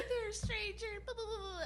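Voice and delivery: high, playful flirting voice; flirty role play. Pretend. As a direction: sweet-talk a stranger at the bar. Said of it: "That's the time where you role play".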